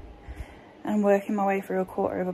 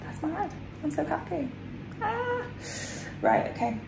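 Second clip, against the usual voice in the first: higher, rising and delighted; excited; 7 semitones higher, 4 dB quieter than usual. Real, not acted. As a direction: lift it up in happy disbelief. Happy repeat with rising tone.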